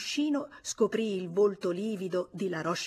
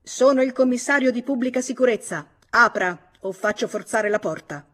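loud, higher official threat; stern commissioner, loud and threatening; threatening shout; 4 semitones higher, 8 dB louder than usual. A narrator's reading. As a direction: shout the threat through the door. Commissioner threatens to force door.

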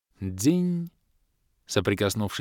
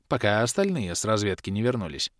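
usual speaking voice

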